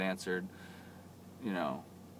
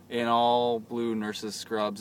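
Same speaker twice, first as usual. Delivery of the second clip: ordinary delivery, nothing marked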